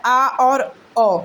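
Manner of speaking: आ and ओ stretched very slowly with the mouth wide open; teacher slowly demonstrating the open vowels आ and ओ; drawn-out open vowels. Shown as a demonstration. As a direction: hold आ and ओ long, slow and wide-mouthed, demonstrating. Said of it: "आप देखेंगे हमारा मुख पूरा खुल रहा है".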